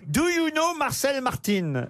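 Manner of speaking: slow, high mock-Mexican accent; playful Mexican-accent punchline; joke Mexican accent. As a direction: put on a Mexican accent, drawing it out. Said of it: "Au Mexique, on dit".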